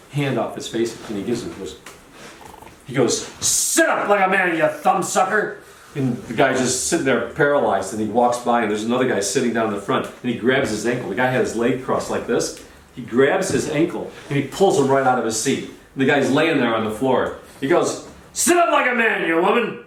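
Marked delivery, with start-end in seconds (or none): 3.4-5.6 high-pitched hollered scolding, last word stretched; mock berating. Charlie berates a slouching man in the audience, mock berating. 18.3-19.8 very high, hollered scolding; mock berating. Charlie berates the man he pulled from his seat, mock berating.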